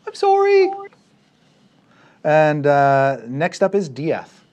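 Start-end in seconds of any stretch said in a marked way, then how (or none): 0.1-0.7 loud falsetto apology; very high put-on voice; high character apology.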